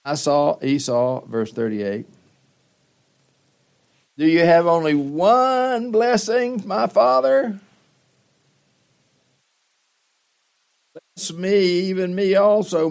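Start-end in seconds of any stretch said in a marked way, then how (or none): 4.2-12.9 anguished plea. Acted. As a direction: cry out to your father for one more blessing. Esau begs for a blessing.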